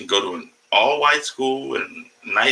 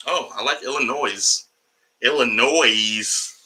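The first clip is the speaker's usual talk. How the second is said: ordinary talk, nothing marked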